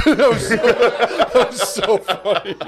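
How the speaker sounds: high, drawn-out words through laughter; real helpless giggles breaking up the words; laughing hard. Real, not acted. Comic amused by own childish memory, laughing hard.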